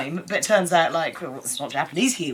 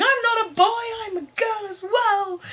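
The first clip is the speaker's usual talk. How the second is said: much higher, hammed-up indignant protest; imitating a dubbed protest; mock indignant. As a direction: protest in an exaggerated put-on voice. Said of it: "Mari recalls going".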